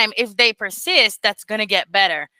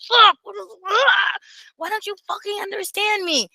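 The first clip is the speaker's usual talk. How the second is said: high exasperated cry of frustration; mock frustrated outburst, pitched high; 10 semitones higher than usual. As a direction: vent at a partner who won't understand. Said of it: "And then you're like".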